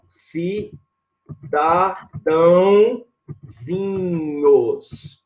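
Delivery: "Cidadãozinhos" in very slow, widely separated syllables. Shown as a demonstration. Teacher building the plural for students, very slow syllable by syllable. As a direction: spell out "Cidadãozinhos" syllable by syllable with long pauses. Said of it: "você vai fazer assim, ó".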